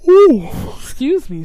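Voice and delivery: yawning mid-sentence. Real, not acted. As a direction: yawn breaking into the words. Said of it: "I yawned over there".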